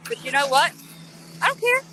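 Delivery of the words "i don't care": I don't care jumped much higher in pitch, care rising; defiant high repeat. Real, not acted. Defiant raised repeat of her words.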